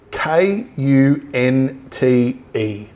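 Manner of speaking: letters spelled out slowly, one by one; spelling it out. Shown as a demonstration. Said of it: "I'm gonna spell it for you".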